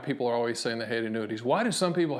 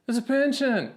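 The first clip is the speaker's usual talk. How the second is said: emphatic punchline; 11 semitones higher, 4 dB louder than usual. Real, not acted. Speaker to viewers, excitedly revealing annuities are pensions. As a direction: pitch jumps way up on the payoff.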